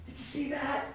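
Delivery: put-on a kid amazed at his own shot; amazed little kid. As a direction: breathe it out like a stunned five-year-old. Five-year-old thrilled by his hit.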